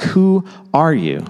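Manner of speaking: slowed right down; slow searching question. Shown as a demonstration. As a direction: let the question hang, slowly. The world's question, slowly posed.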